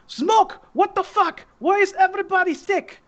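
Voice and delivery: high-pitched angry yell as a big Kazakh woman. Acted. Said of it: "she starts yelling at Smoke".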